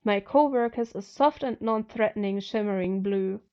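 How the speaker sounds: ordinary delivery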